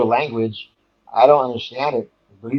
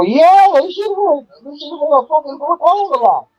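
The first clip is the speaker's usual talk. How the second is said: emphatic disbelief; 4 dB louder than usual. Retold or imitated. Storyteller answering the man whose arms hurt, emphatic disbelief. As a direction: squeal the obvious answer in comic exasperation.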